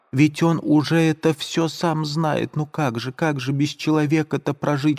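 put-on a frightened girl pleading; distraught Sonya. Sonya's voice continues.

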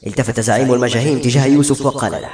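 plain reading voice

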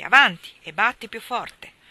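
loud, firm order to strike harder; energetic command. A narrator's reading. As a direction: bark it out, full of authority. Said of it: "disse energicamente".

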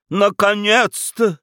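high, drawn-out relief; relieved exclamation. A narrator's reading. Baroness greeting the awaited burgomaster, relieved. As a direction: let it out slowly, relieved and high. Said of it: "облегчённо вздохнула баронесса".

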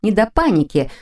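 plain reading voice

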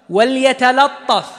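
وليتلطف said slowly with a thin light lam. Shown as a demonstration. Tajweed teacher modelling وليتلطف for students, slow and light. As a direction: stretch وليتلطف and keep both lams thin. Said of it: "رقق اللام".

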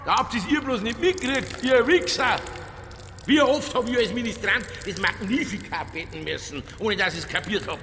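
high, heated defiant rant. Acted. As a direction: taunt the priest. Detective rants defiantly in church. (trotziges Wettern)

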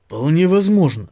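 ordinary delivery

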